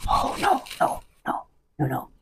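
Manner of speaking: breathy whispered oh no; hushed repeated no's; housekeeper's hushed dismay. Retold or imitated. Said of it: "housekeeper reacts".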